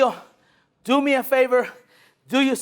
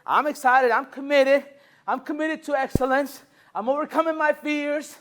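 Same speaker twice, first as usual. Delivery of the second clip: high, loud pumped-up voice; excited customer; 6 semitones higher, 6 dB louder, slower than usual. Retold or imitated. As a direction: hype yourself up. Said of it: "I got Trino excited, like".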